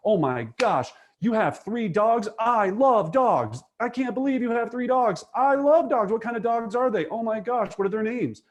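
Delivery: high, loud, gushing excitement; amped up. Acted. Agent bonding with a client over three dogs, amped up. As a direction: gush with over-the-top phone energy. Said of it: "you gotta be so amped up on the phone".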